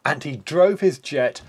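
lively read-aloud narration; story narration. A narrator's reading. Narrator telling children about the race, lively read-aloud. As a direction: tell the race to children with gusto.

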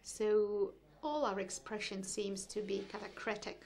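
usual speaking voice

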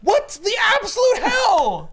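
high, loud laughing outburst; incredulous shout. Real, not acted. Fan reacting to the absurd ending, shouting high. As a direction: throw up your hands in disbelief. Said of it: "outraged at the book".

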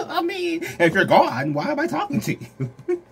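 high laughing through the words. Real, not acted. Tarot reader teasing viewers who left, laughing high.

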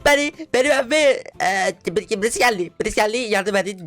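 breathless mock call for help; frantic phone call.

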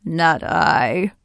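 lightly voiced sly crocodile; slippery crocodile. A narrator's reading. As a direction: refuse it like a slippery croc. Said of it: "said the slippery crocodile".

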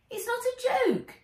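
put-on very high earnest boy; serious little boy.

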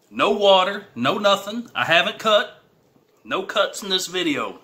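fired-up spicy challenge bravado; excited boast. Real, not acted. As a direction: brag through the burn. Bragging through spicy challenge.

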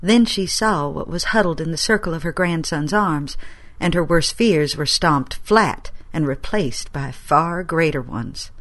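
ordinary delivery

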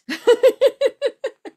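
bright high burst of laughter. Real, not acted. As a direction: laugh out loud at the joke. Laughing at joke.

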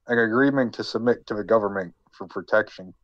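ordinary delivery

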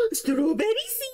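sudden thrilled shriek; excited gasp. Acted. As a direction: gasp and squeal the strawberry seed. Reads NPC line, jumps to excitement.

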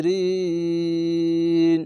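কাফিরিন recited slowly with the ee held long; pausal recitation. Shown as a demonstration. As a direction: stretch কাফিরিন over three counts before the stop. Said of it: "তিন আলিফ টান হবে".

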